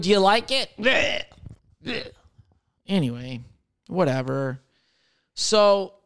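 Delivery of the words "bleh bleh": mock gagging bleh; disgusted bleh. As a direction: spit out a grossed-out bleh. Disgusted noise.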